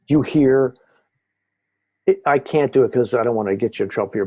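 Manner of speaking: normal talking voice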